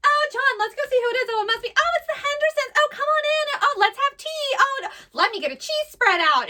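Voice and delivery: high, loud, sing-song fifties hostess; 1950s housewife. Retold or imitated. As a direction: ham up a cheery old-time housewife. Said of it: "the people are like".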